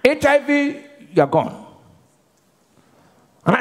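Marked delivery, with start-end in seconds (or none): none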